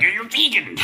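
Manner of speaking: high-pitched hammed-up outburst; mock threatened meat eater. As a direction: mimic someone rattled, far above your own voice. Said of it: "They're like".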